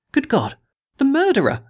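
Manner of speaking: rising to a high, strangled cry of fright; a frightened man recognising a murderer; choked in terror. A narrator's reading. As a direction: choke it out, horrified. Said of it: "he choked".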